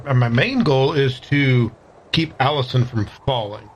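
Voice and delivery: ordinary talk, nothing marked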